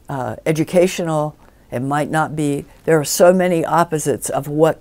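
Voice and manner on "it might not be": ordinary talk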